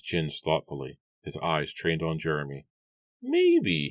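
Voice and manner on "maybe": much higher, grudging farmer's voice; reluctant Matthew. A narrator's reading. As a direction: give in unwillingly as the gruff farmer. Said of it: "he muttered reluctantly".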